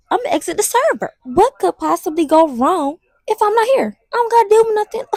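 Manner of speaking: high, self-satisfied mock reasoning; mock confident scheming. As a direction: act out a foolishly bright idea. Acts out her past bright idea.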